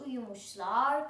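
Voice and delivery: usual speaking voice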